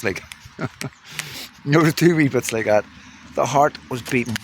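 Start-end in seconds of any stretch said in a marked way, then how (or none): 0.0-0.7 words dissolve into a soft airy laugh; laughing softly. Real, not acted. Recalling the scary climb, a soft laugh. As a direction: let the laugh swallow the words.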